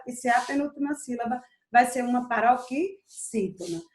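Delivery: ordinary talk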